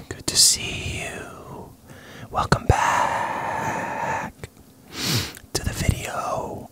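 slow breathy ASMR whisper. Shown as a demonstration. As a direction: whisper slowly into the mic. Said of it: "Would you like some A S M R?".

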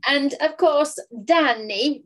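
put-on name-drop like a proud old ballet master; mock Russian ballet master. Actor imitating his old coach name-dropping a famous pupil, mock ballet-master voice. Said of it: "I don't know what that voice is that I'm doing".

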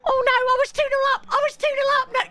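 high, fast despairing whine; high panicked whine; whiny wail. Real, not acted. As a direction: squeal it in dismay. Whines after conceding.

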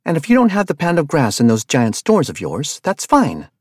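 loud, high-pitched angry tirade; yelling in his face, outraged; furious rant. Acted. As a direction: tear into him, furious and loud. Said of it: "launched herself off of the van".

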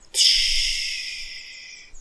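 long unvoiced "trz" hiss; imitated hissing noise. Real, not acted. Player making a noise while building, drawn-out hiss. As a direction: let a noisy hiss trail away.

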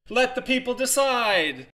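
high, slow rallying shout. Acted. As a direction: rouse the crowd. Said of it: "she turned back to the crowd and shouted".

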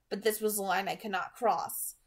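plain reading voice

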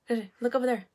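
normal talking voice

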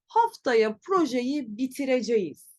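bitireceğiz in full, bi-ti-re-je-yiz; written form. Shown as a demonstration. Teacher shows friends how not to say bitireceğiz, written form. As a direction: pronounce bitireceğiz letter by letter as spelt. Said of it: "Tabii ki böyle söylemiyoruz".

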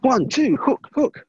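sharp, punchy count calls; boxing coach calling combos. Acted.

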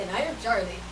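put-on Indian accent, coaching a co-host; demonstrated Indian accent. Shown as a demonstration. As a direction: show the line in an Indian accent. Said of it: "You have to say".

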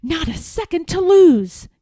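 high, loud urgent gasp; breathless with alarm; panicked gasp. A narrator's reading. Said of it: "gasped Eric".

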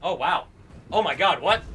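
ordinary talk, nothing marked

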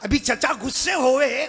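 usual speaking voice

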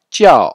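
jiao high and sharply falling, slow. Shown as a demonstration. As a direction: model jiao with a strong fourth tone. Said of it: "c'est ao". (jiao au quatrième ton, appuyé et descendant)

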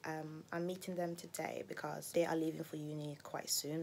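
normal talking voice

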